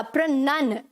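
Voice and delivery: प्रनन as "pruh-NUN"; short "nun", no "ow", in प्रनन; correct pronunciation. Shown as a demonstration. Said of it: "नाउन नहीं आएगा".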